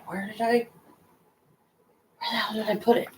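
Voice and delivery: quiet, anxious panicked muttering; panicking. Real, not acted. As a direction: search in a hushed panic. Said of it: "I'm panicking a little bit".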